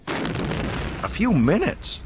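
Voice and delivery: high, drawn-out incredulous question; blurted disbelief. A narrator's reading. Luke alarmed at Solo's delay, incredulous blurt. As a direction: echo it in disbelief, pitch shooting up. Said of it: "Luke blurted".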